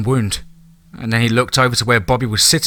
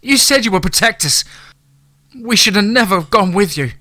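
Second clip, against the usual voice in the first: high-pitched, desperate screamed accusation; wife screaming at the man who failed to protect them; 9 semitones higher, 3 dB louder than usual. A narrator's reading. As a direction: scream it at him, pounding in grief. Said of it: "Deborah screamed at him".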